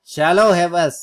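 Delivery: loud burst of urging; cheering shout. Real, not acted. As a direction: bark it at the ticker. Cheering the stock again. (ज़ोरदार जोशीली पुकार)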